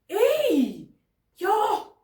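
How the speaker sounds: high, loud shout; loud call out. Real, not acted. Sudden outburst calling out.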